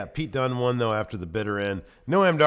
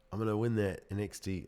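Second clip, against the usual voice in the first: put-on British accent; 2 semitones lower, 8 dB quieter than usual. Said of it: "doing this British accent every single goddamn time".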